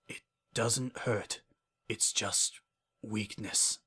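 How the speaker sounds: soft, fading murmur; faint dying voice. Acted. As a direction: let the words die away.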